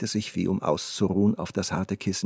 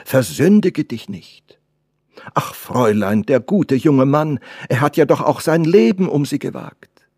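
put-on loud, raised old servant's reproach; emphatic, higher-pitched admonishing; reproachful cry; 6 semitones higher, 7 dB louder than usual. A narrator's reading. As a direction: plead loudly with the girl. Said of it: "rief die Alte".

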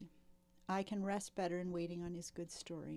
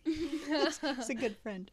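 laughing; 8 semitones higher, 7 dB louder, much slower than usual. Real, not acted. Laughing fondly about friend.

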